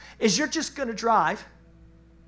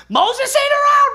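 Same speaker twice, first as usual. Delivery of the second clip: much higher, louder mock panic; hammed-up crowd panic; panicked cry; 10 dB louder than usual. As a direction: shout it like a scared crowd. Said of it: "everybody goes".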